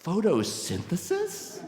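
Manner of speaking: high, slow, drawn-out disbelieving question; incredulous. Retold or imitated. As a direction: stretch the word out in baffled disbelief. Acts out disbelief at advice.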